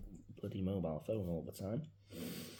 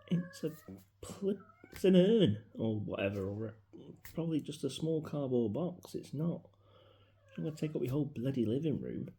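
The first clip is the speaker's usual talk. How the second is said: louder, higher frustrated protest; exasperated retort; 7 semitones higher, 5 dB louder than usual. Retold or imitated. Son retells arguing with dad over the urn: exasperated loud protest. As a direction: push back at him, fed up.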